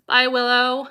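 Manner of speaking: ordinary talk, nothing marked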